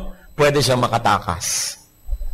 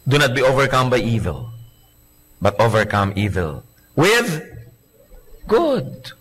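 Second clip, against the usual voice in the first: slow, deliberate reading ending on good; solemn scripture reading; 4 semitones lower, slower than usual. Shown as a demonstration. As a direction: read the verse with weight. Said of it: "Romans twelve twenty-one". (taimtim na pagbasa ng talata)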